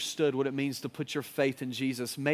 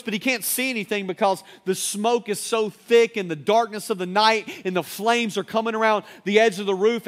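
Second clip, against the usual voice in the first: ordinary delivery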